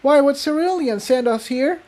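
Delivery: high, alarmed pony voice; a high, worried question; alarmed mare. Acted. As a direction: demand answers in a panic. Frantic argument amid collapse.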